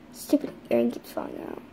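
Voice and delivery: fast, annoyed whisper to herself; whispered grumble. Pretend. Toy fussing over her earring, fast whisper. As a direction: grumble it under your breath. Muttering to self about earring.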